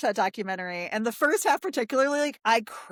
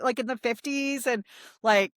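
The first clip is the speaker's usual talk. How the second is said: ordinary delivery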